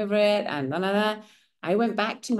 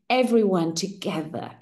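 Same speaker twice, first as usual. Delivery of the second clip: ordinary talk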